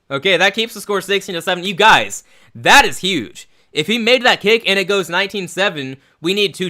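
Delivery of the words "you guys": usual speaking voice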